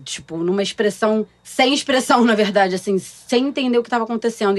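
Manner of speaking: normal talking voice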